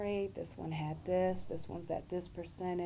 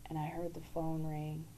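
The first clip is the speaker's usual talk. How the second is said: ordinary talk, nothing marked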